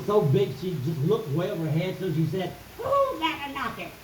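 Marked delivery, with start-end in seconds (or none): 2.8-3.9 giant's wife calling. Acted. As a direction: sing out high to an unseen visitor. Giant's wife calls over Jackie's head.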